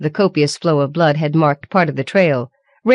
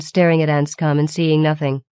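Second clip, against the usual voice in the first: ordinary delivery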